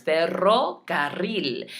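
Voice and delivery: "Ferrocarril" drawn out with strongly trilled double R's; slow teaching demo of "Ferrocarril", R's trilled; rolled R's. Shown as a demonstration. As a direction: stretch "Ferrocarril" and roll both rr sounds. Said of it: "you need to roll all four R's".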